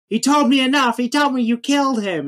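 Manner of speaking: high, loud acted defiance; Luke Skywalker. Podcaster as Luke answering Vader, high loud anguish. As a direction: hurl an anguished accusation. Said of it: "And he goes".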